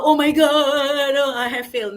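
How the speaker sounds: hammed-up despairing student, loud then fading; mock panic. Mimics a panicking candidate.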